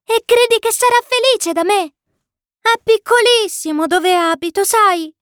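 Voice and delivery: put-on high, excited child's voice; joyful little prince. A narrator's reading. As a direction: ask eagerly in a small high voice. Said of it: "esclamò pieno di gioia". (vocina acuta e gioiosa)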